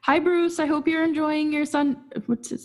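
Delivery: high-pitched, playful hello to a coach; playful mock greeting. Playfully addresses coach as imagined listener.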